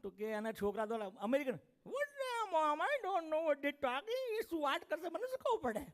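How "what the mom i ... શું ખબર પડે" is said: put-on high, slow whiny American kid; whiny American son. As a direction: drawl it out like a bored American teen. Americanized son mocks Indian talk.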